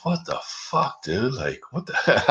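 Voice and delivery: a touch of nervous disbelief, half laughing; retold nervousness. Man recalling a third cop arriving, mildly nervous. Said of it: "Like, I'm nervous".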